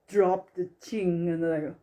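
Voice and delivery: ordinary delivery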